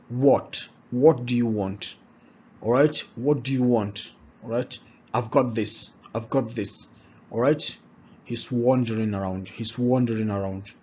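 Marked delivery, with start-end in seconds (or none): none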